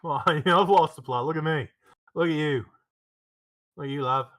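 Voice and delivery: put-on Australian accent. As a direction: play it Aussie and cheeky. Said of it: "did he just switch accents".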